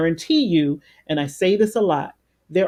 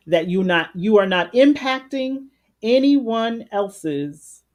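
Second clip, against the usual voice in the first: ordinary talk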